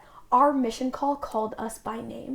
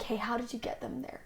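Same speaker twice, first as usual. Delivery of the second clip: unvoiced hush, much softer; whispered question; 10 dB quieter than usual. Missionary quietly asks colleagues their secret, whispering. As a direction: ask it in a hushed aside.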